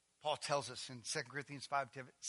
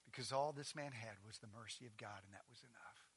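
softer, lower gentle reply; tender assurance; 5 semitones lower, 8 dB quieter than usual. Retold or imitated. Jesus answering the dying thief, soft and gentle. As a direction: reassure the dying thief quietly. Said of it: "He says".

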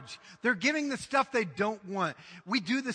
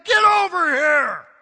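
loud, higher, slow coach yell; coach screaming; 7 semitones higher, 13 dB louder, slower than usual. Retold or imitated. Coach yelling at a slacking player, loud and slow. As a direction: bellow it across the field. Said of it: "screaming and yelling".

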